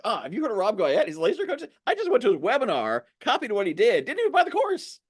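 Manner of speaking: high, gushing word-of-mouth voice; animated imitation of a thrilled client; excited customer. Voices client telling friends.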